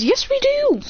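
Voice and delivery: high-pitched, exaggerated comeback; indignant retort. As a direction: argue back in a squeaky mock outburst. Acted argument about the scroll.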